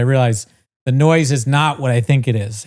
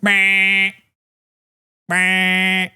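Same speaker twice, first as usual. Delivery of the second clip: nasal-free mock buzz, higher and drawn out; droning noise; 6 semitones higher than usual. Man imitating the neighborhood noise with a high droning meh. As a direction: imitate the buzzing alarm noise. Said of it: "this noise is going off".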